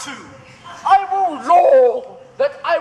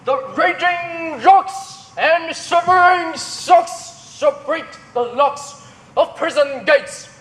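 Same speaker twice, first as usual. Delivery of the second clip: ranting tyrant; 2 semitones higher than usual. Acted. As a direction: declaim like a raging tyrant. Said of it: "This is Achilles' vein, a tyrant's vein".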